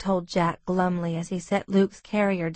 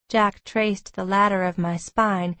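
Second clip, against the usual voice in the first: usual speaking voice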